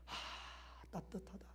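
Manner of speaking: soft, breathy murmur of being moved; 감동한 듯 속삭이며; touched sigh. Retold or imitated. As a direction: whisper it like a visitor touched by warmth. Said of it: "들어오면서부터 은혜를 받는 거예요".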